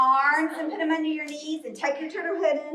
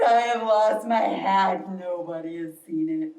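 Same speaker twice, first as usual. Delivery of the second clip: sad bear; 8 semitones lower than usual. Acted. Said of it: "And Bear says".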